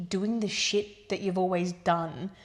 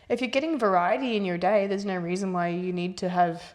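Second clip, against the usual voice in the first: ordinary delivery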